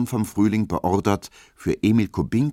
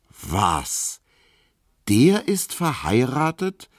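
much higher, slowed disbelief on verheiratet; incredulous; 6 semitones higher, slower than usual. A narrator's reading. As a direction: draw out the astonished question. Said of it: "fragte Emil Kubinke ungläubig". (ungläubig, hoch und gedehnt)

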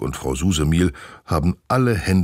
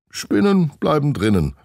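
put-on reassuring boy; 11 semitones higher, 4 dB louder than usual. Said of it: "versichert Olaf".

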